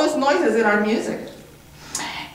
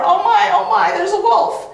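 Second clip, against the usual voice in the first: panicked duck; 11 semitones higher than usual. Acted. Duck spotting the wolf, high and panicky. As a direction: flap about in alarm.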